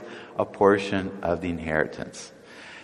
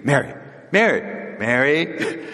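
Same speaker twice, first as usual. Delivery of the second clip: calling out; 6 semitones higher, slower than usual. Acted. Martha calling her sister repeatedly.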